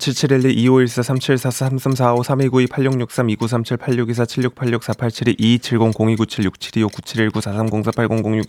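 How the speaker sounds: rattling names off without a breath; all in one breath. Shown as a demonstration. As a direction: race through the list on one breath. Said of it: "제가 한숨에 읽어서".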